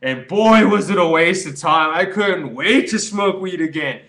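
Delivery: hammed-up stoner relief; mock stoner. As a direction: overplay the relapse confession. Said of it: "You're not gonna be like".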